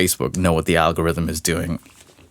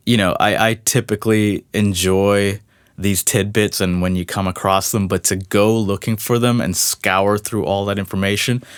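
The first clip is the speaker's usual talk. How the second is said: ordinary delivery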